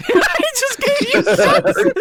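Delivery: loud, very high, through laughter; laughing hard. Real, not acted. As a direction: crack up while saying it. Cracking up at the joke.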